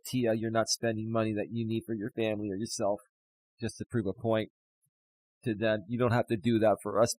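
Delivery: moved, quiet concern; tearful. Real, not acted. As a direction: plead gently through emotion. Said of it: "I'm losing my shit".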